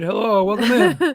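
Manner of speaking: loud, high cheery hello; excited greeting. Real, not acted. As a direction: welcome her in brightly. Greeting LSU mom on stream.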